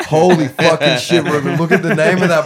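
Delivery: loud, slow, laughing shout; cracking up at the sign; laughing exclamation. Retold or imitated. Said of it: "And I was like".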